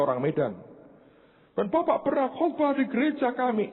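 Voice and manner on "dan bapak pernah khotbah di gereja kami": high, insistent mock-tearful voice; imitated crying. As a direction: act out the pleading woman caller. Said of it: "dia nangis keras". (menirukan ibu yang memelas)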